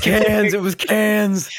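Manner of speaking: high, loud mock movie shout; Keanu yelling. Film fan imitating the hero reassuring the driver, yelling. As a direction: imitate the hero hollering over the noise. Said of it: "And then Keanu goes".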